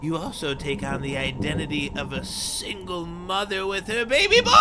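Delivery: high voice, building to an excited shout; character pitching a disguise. Acted. To the player about a mother disguise, high and mounting. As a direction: draw it out high, then burst out at the end.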